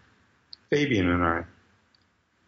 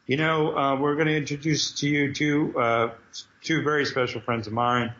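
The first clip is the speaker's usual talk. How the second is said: raised, showy announcer voice; announcer introduction; 3 semitones higher than usual. Retold or imitated. Cyclops introducing the writers to the crowd, announcer style. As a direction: build up to "Knee Cheese" like a host. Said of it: "And Cyclops goes up and he goes".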